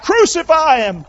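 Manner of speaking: high, loud drawn-out shout; crowd crying out. Acted. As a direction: cry it out like the mob demanding death. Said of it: "they cried out".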